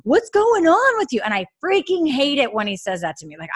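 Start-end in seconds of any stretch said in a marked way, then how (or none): 0.0-1.2 high, louder mock husband asking what's wrong; hammed-up puzzled husband; imitating husband. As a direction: play the baffled husband. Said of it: "his first reaction is always like".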